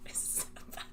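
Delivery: soft whisper, almost no voice; a hushed confession. Real, not acted. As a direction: whisper it in mortified disbelief. Reliving embarrassing talk.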